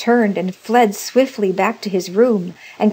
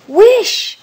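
very high, loud command; strong cry; 11 dB louder than usual. A narrator's reading. Wife commanding her husband to wish, strong loud cry. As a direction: cry the order out at full voice. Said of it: "repeated his wife".

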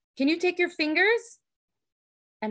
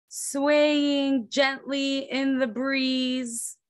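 usual speaking voice

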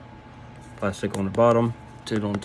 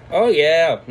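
laughing; 5 semitones higher, 5 dB louder than usual. Real, not acted. Laughs after first taste.

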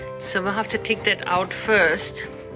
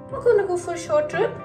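high, lilting voice for a dog; pet talk; 7 semitones higher, 4 dB louder, faster than usual. Real, not acted. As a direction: tell the dog about an outing, pitched high. Promising the dog a trip.

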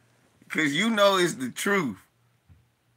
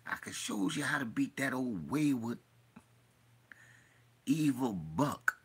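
low sinister whisper; 4 semitones lower, 10 dB quieter than usual. Retold or imitated. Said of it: "He said".

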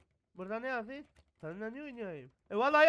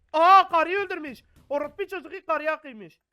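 high, very loud horrified outcry; shocked scream; 6 semitones higher, 16 dB louder than usual. Real, not acted. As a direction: scream in horror at the body. Découvre un meurtre.

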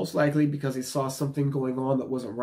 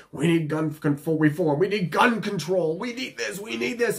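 raised, insistent protest demand; mock gun-control activist; 6 semitones higher, 3 dB louder than usual. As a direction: clamour higher and higher, mocking campaigners. Voices gun control advocates' demands.

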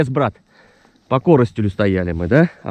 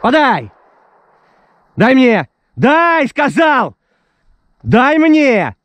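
stern shouted commands; 10 semitones higher, 5 dB louder, slower than usual. Real, not acted. Demanding the dog bring the bird.

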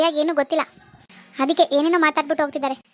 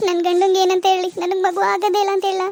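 put-on higher, tearful despairing young wife; tearful daughter; 4 semitones higher than usual. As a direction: complain to mom through tears. Daughter blames herself crying. (ಅಳುವ ಮಗಳು)

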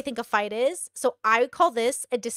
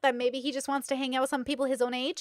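ordinary delivery

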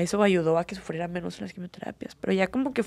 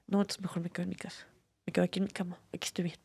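much softer, half-whispered resignation; quiet inner voice; 10 dB quieter, faster than usual. Retold or imitated. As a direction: murmur it to yourself, giving up. Retelling her own fearful thoughts. (voz baja, casi susurrada, resignada)

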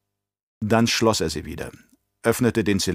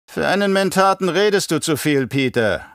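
growling; 8 semitones higher, 3 dB louder than usual. Acted. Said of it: "knurrte der Baron".

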